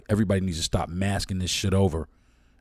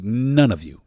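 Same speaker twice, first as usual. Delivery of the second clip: none of you, shouted louder; loud angry repeat; 3 semitones higher, 6 dB louder, slower than usual. Real, not acted. Ranting at police officers, a loud angry none of you. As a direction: hurl the words at the police.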